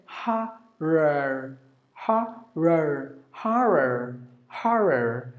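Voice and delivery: HORROR in American, "ha-rer" with R sounded; American pronunciation. Shown as a demonstration. Teacher showing American horror, American pronunciation. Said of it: "If it is American".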